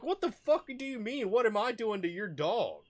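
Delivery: very high, loud, incredulous retort; outraged mimicked shouting match; retold angry comeback. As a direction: reenact yelling back at the owner. Acted quarrel with the owner.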